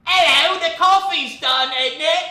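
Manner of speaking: high, loud, slow posh English voice; mock British accent. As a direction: camp it up as a shrill Brit. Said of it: "they sound like this".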